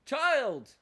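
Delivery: high, loud yell; calling out. Real, not acted. Player yelling at a game character, high shout. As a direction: holler the name in one burst.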